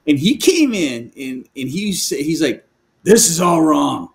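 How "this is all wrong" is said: bossy order. Retold or imitated. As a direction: lay down the law to him. Guest leader bossing him around.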